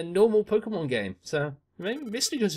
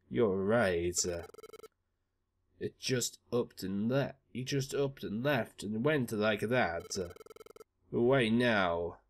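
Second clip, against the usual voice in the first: much lower, grave and mournful; deep-voiced companion; 10 semitones lower, 4 dB quieter than usual. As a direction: speak slowly of the one who left. Deep-voiced character reflecting on loss.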